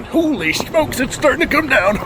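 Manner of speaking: high surprised exclaim; startled by the downpour. Real, not acted. Farmer caught in a sudden downpour, surprised.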